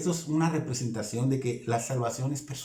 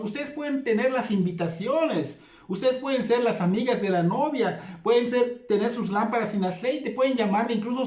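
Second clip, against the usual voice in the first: higher, insistent pulpit delivery; impassioned sermon, higher; fervent preaching; 7 semitones higher than usual. Acted. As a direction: drive the warning home to the congregation. Preaching at full force.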